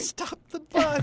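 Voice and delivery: high, slow, laughing call; laughing mock order. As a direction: echo it as a mock command, laughing. Laughing echo of bus question.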